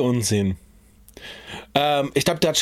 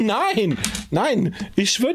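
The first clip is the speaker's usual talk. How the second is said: lachend; 7 semitones higher, much slower than usual. Real, not acted. Laughing disagreement with video.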